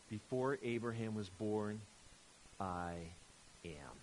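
low, hushed and slow; solemn declaration. Acted. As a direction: let each word land with weighty pauses. Reads Jesus' climactic answer.

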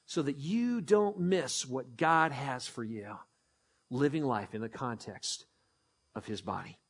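dropping to a near hush; softening. Real, not acted. Pastor easing the congregation into a hush, softly. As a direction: let the point sink in softly.

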